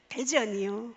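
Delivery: put-on easygoing Chungcheong accent; Chungcheong dialect announcement. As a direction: drawl the stop in lazy Chungcheong style. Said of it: "충청도, 대전 이쪽으로 가면".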